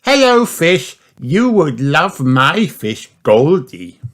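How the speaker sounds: high, bright little pig voice; high child voice; Peppa's voice. Acted. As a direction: play the excited piglet. Peppa's lines in a children's story.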